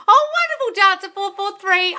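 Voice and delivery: high, loud delighted squeal at a viewer's news; excited squeal. Real, not acted. Livestream host to a viewer with birthday news, a high, loud excited squeal.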